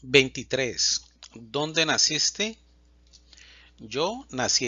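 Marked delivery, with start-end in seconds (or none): none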